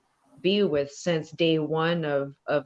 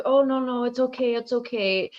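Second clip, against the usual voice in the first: soothing; 5 semitones higher than usual. Retold or imitated. Auntie soothing fallen niece.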